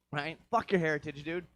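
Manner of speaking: ordinary talk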